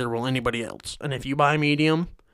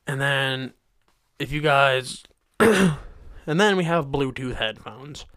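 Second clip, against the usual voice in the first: ordinary delivery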